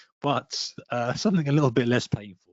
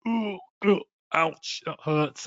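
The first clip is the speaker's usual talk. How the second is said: higher, slow hammed-up ouch; mock wince of pain; 5 semitones higher, slower than usual. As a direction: act the sting of giving money away. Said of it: "You would feel pretty like".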